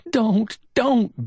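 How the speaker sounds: high, pleading don't don't; begging. A narrator's reading. Billy begs McMain to stop talking of Tommy, pleading. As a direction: beg him to stop, high. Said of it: "Billy begged".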